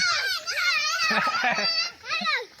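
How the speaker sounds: squeaky high-pitched giggle. Real, not acted. Laughs after greeting.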